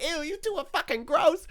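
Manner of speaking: high-pitched imitation of appalled relatives; mock disgust. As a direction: squeal it as the grossed-out crowd.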